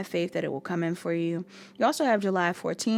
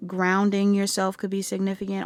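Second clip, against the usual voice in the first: normal talking voice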